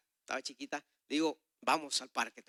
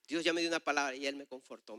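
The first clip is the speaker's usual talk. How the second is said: ordinary talk, nothing marked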